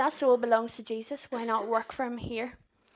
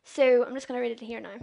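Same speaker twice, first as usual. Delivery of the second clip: ordinary talk, nothing marked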